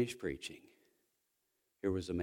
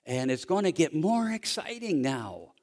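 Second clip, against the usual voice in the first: higher and louder, pushing toward a climax; fiery preaching; 7 semitones higher, 6 dB louder than usual. Acted. Pastor preaching hope to congregation, rising fiery delivery. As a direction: preach it full force, getting more excited. Preaching builds to full force.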